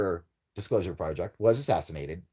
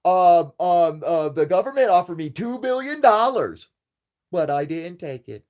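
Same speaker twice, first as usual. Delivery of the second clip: high, louder hesitant fake-innocent boast; mocking liar voice; 8 semitones higher, 8 dB louder than usual. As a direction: sound like a bragging liar fumbling for words. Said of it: "someone obviously is like".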